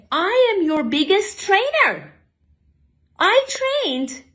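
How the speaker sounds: high, strained, indignant protest; retold upset protest. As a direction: pour out the hurt to the boss. Confronting the boss, upset.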